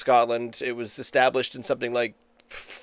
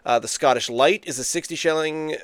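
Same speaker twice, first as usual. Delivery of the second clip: ordinary talk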